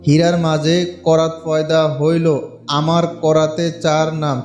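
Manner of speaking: slow, solemn chant of a spell, low and loud; mantra recitation. Shown as a demonstration. Vlogger reciting the mantra for viewers, slow incantation chant. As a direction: intone each line like an incantation. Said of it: "মন্ত্রটা আমি আপনাদেরকে উচ্চারণ করে শোনাচ্ছি".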